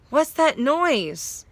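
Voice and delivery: high, slow frightened question; a sleepy boy, suddenly scared. A narrator's reading. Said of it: "half awake but clearly frightened".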